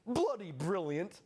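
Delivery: slow mock British old chap. Host mocking the Brits, slow British old chap voice. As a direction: draw it out like a posh old Brit. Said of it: "as the old chaps might say".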